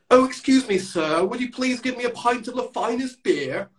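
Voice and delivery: high, plummy posh English put-on; mock an over-polite posh pub customer; posh RP accent. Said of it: "if you're speaking like that".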